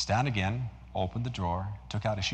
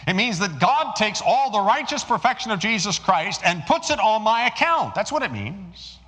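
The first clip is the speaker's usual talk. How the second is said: high, loud preaching at full force; 7 semitones higher, 5 dB louder than usual. Acted. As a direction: proclaim it to the whole congregation.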